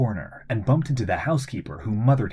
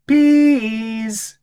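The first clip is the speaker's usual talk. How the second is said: put-on high squeaky toddler; baby voice; 13 semitones higher, 8 dB louder than usual. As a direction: chirp it like a little baby. Baby Jeannie asks for food.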